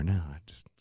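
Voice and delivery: whisper. Retold or imitated. Imitating wife refusing the podcast.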